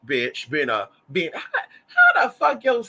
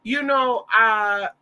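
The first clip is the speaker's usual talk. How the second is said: higher, drawn-out mock-cute greeting; imitated coy, stalling hello; trying to be cute; 6 semitones higher, much slower than usual. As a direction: play a man acting cute to smooth things over. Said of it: "trying to be all cute".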